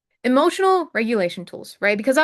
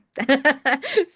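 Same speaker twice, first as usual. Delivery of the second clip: real laugh; 4 semitones higher, 5 dB louder than usual. Real, not acted. Laughing at her own confession.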